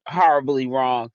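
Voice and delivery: ordinary talk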